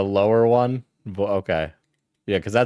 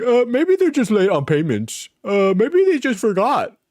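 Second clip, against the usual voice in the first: higher, dopey mock voice of naive creators; mocking the YouTubers; 9 semitones higher than usual. Streamer mocks his network colleagues in a dopey voice. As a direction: play them clueless and limp. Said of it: "all these guys were like".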